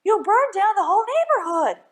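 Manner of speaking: high, loud alarmed cry; mother crying out at the burning roof. Acted. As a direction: shout the warning. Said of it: "cried his mother".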